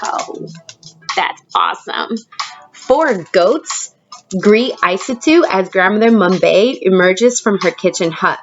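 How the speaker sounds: ordinary delivery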